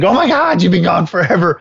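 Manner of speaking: high, frantic needy dog voice; anxious dog. Acted. Needy terrier greeting his owner after seconds, high frantic voice. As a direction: gush like a panicked pet whose owner just came back. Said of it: "he's all anxious and is like".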